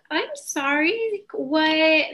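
put-on stunned, pressing the child to explain; mother shocked at what her son was told; shocked concern. Retelling her reaction to child's news.

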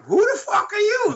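very high, shouted mock disbelief; hammed-up outraged retort; mock indignant Oz. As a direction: squawk it like a shocked celebrity doctor. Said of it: "Doctor Oz just looked at him like".

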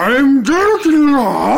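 slow, loud, high put-on voice; Richard Nixon impression. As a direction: drag it out in a put-on voice. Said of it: "you sound like Richard Nixon".

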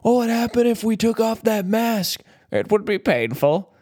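high put-on menacing villain line. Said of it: "when he's like".

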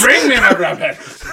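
slow, loud, high captain's order; hammed-up laughing command; mock sea captain. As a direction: bellow the order while laughing. Echoes the anecdote's captain, laughing.

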